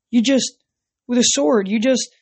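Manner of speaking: can't finish the thought, amazed; stunned. A narrator's reading. Nico gapes at Percy after the fight, stammering in amazement.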